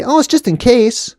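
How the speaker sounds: high, airy mocking voice; mock official reassurance. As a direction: play down the danger in a high pitch. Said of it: "And they're gonna say".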